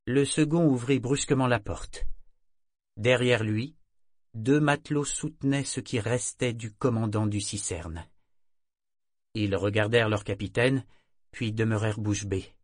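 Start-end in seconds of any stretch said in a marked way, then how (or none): none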